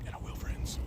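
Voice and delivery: a hushed promise slipped into the reading; whispered aside. Acted. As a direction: drop to a whisper for a quick aside. Aside while reading the note.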